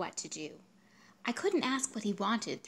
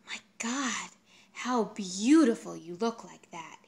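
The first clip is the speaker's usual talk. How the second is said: awed; 7 dB louder, slower than usual. Acted. Lover's awed compliment.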